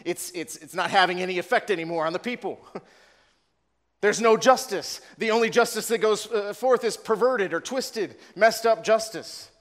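high, loud desperate plea to God; anguished prophet. Retold or imitated. Preacher voicing Habakkuk crying to the Lord, high and pleading. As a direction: cry out like a prophet at the end of his rope. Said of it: "He says".

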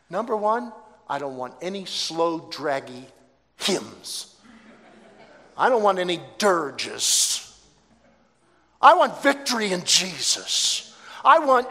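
emphatic, building to high, loud fervour; retold joy. Dying woman plans her service with pastor, exuberant. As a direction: lay down demands with rising exuberance. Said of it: "Because she had such joy".

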